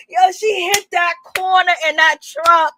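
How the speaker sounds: ordinary delivery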